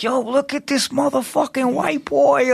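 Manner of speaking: high mocking homeboy voice; imitating homeboys. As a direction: sneer it like a guy in the crowd. Homeboys mocking Brian's dancing.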